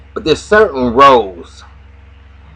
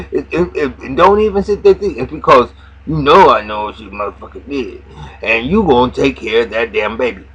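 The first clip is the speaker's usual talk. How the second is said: mock Lucius scolding; 7 semitones higher than usual. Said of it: "He said".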